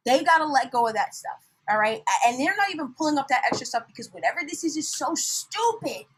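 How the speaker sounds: ordinary delivery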